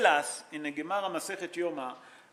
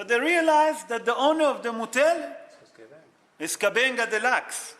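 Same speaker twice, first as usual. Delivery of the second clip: excited storytelling; 5 semitones higher, 8 dB louder than usual. Real, not acted. Dramatic climax of the story.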